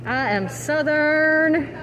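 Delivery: proudly stretched Southern vowels; Southern accent. Shown as a demonstration. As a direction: lean into a drawn-out Southern accent. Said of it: "I keep my accent turned off".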